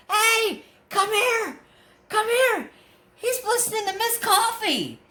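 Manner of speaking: high-pitched call across the hall; mock yelling. Woman summoning coworker across the hall, high mock yell. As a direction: imitate her calling a coworker over. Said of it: "yelling at the lady across the, the hall".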